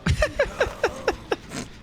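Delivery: high breathy wheeze laugh; real wheezing giggle; squeaky giggle. Real, not acted. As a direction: giggle helplessly.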